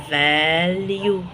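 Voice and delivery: "فاليو" very slow, long held vowels; sounded out. Shown as a demonstration. Teacher introducing "فاليو" to learners, drawn out very slowly.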